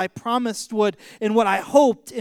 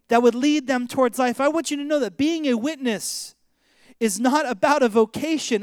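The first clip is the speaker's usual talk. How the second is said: higher, driving sermon voice; raised, emphatic preacher's exhortation; impassioned preaching; 4 semitones higher, 3 dB louder than usual. Real, not acted. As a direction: press it home to the congregation.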